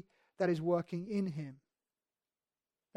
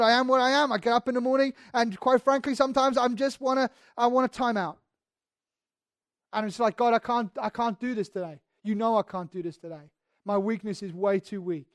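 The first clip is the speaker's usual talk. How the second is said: louder, higher passionate sermon; fervent preaching; 4 semitones higher, 6 dB louder than usual. Acted. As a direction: preach it out, full conviction. Preaching at full force.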